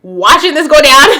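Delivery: worked up as the wife, laughing; indignant excitement. Real, not acted. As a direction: react as if you were the wife, outraged and amused. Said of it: "As the wife, I'm like".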